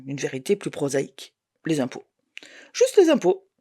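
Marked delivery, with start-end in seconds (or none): 2.7-3.5 exasperated disbelief. A narrator's reading. Narrator finding only taxes drained her account, exasperated. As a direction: pitch jumps way up on "Juste les impôts", exasperated. Discovers the tax bill.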